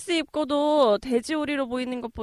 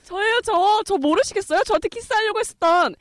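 high, loud eager plea; eager pleading; 9 semitones higher, 9 dB louder than usual. Acted. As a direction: beg him to recognise you. Cinderella pleading with the prince.

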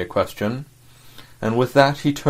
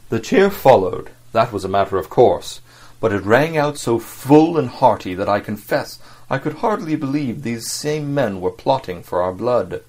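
usual speaking voice

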